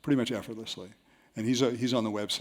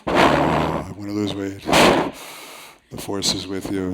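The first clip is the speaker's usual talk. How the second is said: slow, louder mock Vader voice with heavy breaths; Darth Vader; 5 semitones lower, 6 dB louder, much slower than usual. Mimicking the weight-loss caller on the phone, slow mock Vader. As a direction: drag out each word like a masked villain. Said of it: "sounded a little bit like Darth Vader on the phone".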